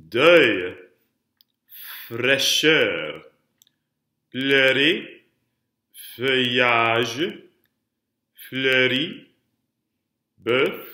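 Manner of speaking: "pleurer, feuillage, fleuri" each lifting at the end; last-syllable emphasis. Shown as a demonstration. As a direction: model each from "Deuil" to "bœuf" for repetition, leaning on the final syllable. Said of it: "putting all that emphasis on the last syllable".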